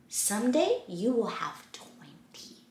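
hushed secret; soft whisper. Acted. Whispered book line.

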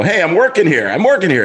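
playful, punchy objection; mock protest. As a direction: protest jokingly at being interrupted. Acted joking protest to the friends.

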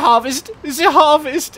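high, loud hammed-up cry; over-the-top comic announcement; mock harvest cry. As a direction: proclaim it in a silly put-on voice. Hammed-up shouting about the harvest.

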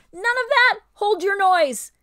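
high, loud snarling command. Acted. Ape silences the protesting beast with a snarl. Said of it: "said the ape with a snarl".

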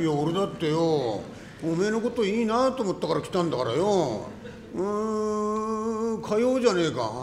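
loud, pleased boasting; loud eager reply. Acted. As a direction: shout yes, flattered. 男が照れて大声で返す. (口説かれた男がうれしくて大声で答える)

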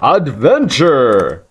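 drawn-out, slow cry of adventure; mock-grand exclamation. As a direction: stretch it out like a grand proclamation. Mock-grand call while exploring.

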